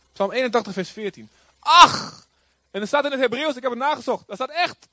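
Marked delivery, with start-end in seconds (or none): 1.6-2.3 loud, high outcry; exclamation of God. Acted. Preacher quoting God's cry to congregation, loud exclamation. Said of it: "Een uitroep van God".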